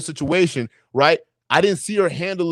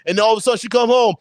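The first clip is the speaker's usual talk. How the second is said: usual speaking voice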